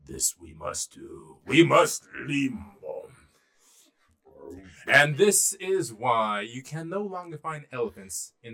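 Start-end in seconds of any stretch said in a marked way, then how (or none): 0.1-2.5 slow, heavy elephant decree; ponderous elephant leader's voice; elephant king. Acted. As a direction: intone it gravely, drawn out, as a huge elephant. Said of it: "looked to its elephant friends and said".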